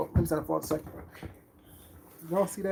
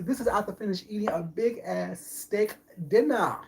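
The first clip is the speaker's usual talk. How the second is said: normal talking voice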